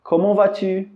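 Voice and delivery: high questioning "Comment allez-vous?"; asking voice. Shown as a demonstration. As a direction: play the asker. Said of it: "Ou então".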